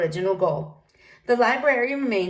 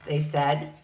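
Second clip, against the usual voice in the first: usual speaking voice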